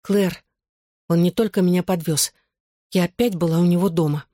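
plain reading voice

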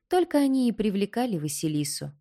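ordinary delivery, nothing marked

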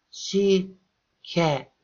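high, halting broken syllables; boy stuck on the start of a word; struggling to speak. A narrator's reading. Boy tries again, syllable by syllable.

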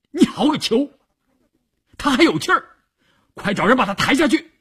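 high, urgent bellowed order; roaring. A narrator's reading. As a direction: roar at a subordinate to get moving. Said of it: "他朝邵月吼道". (急切地吼着下令)

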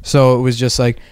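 ordinary talk

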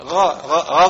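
غاء said with a ghayn for ra; غاء, ra turned into a throaty gh sound; lisped ra as ghayn. Shown as a demonstration. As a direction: imitate a lisper saying غاء. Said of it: "يبدل الراء غينا اللي هو يقول غاء".

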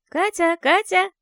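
put-on high, slow, eerie child's call; eerie ghost child. A narrator's reading. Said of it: "Детский голос вновь позвал из темноты".

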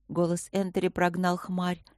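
plain reading voice

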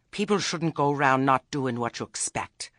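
ordinary delivery, nothing marked

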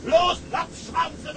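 captain shouting at the deckhands to get moving; furious shout. Acted. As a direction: yell it at the crew like a tyrant. Captain yelling at crew.

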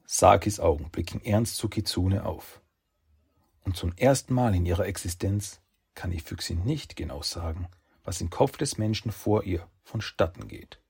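ordinary delivery

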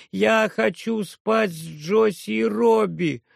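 put-on high, slow childish demand; whiny little girl. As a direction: whine it like a sleepy little girl. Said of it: "заявляет".

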